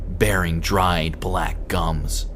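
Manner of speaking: plain reading voice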